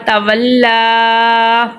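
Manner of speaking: تولا with the final "laa" held very long and level; lengthened vowel. Shown as a demonstration. Teacher showing learners how to lengthen letters, very drawn-out vowel. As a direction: sustain the last vowel of تولا. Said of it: "حرکات کو لمبا کریں گے".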